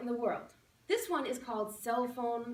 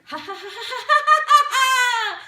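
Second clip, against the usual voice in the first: building laugh; 10 semitones higher, 14 dB louder than usual. Shown as a demonstration. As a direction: laugh growing from normal to very loud and high. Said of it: "And then it gets bigger".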